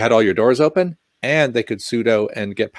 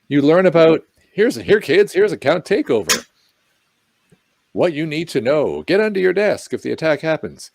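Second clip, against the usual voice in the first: put-on kids' book narrator; 7 semitones higher than usual.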